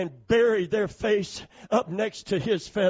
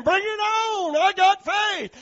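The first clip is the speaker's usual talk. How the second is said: cocky chick; 12 semitones higher, 6 dB louder, slower than usual. Retold or imitated. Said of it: "ruffling up your little downy feathers".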